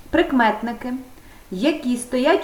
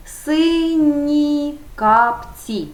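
very slow, higher, carefully drawn out; slow model answer; 5 semitones higher, much slower than usual. Shown as a demonstration. Teacher modelling the phrase for pupils, slowly drawn out. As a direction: stretch every syllable for the class. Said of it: "основа на м'який приголосний".

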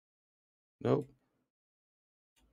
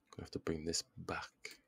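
whisper; 2 semitones lower, 8 dB quieter than usual. Real, not acted. Muttering to himself over board.

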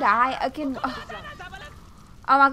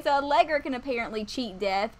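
normal talking voice

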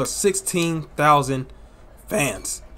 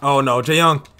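excited live play-by-play calling; wrestling commentator; 5 dB louder than usual. Acted. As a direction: call each move as it lands.

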